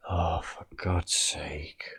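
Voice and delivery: slow, hushed, fed-up whisper; exasperated whisper. Real, not acted. Vlogger stumbling over the text, an annoyed whisper. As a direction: sigh it out under the breath. Frustrated at stumbling while reading.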